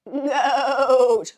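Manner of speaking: blurted out high and loud; excited shout. Real, not acted. Vlogger announcing the emoji to viewers, excited shout. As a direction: shout the word with glee.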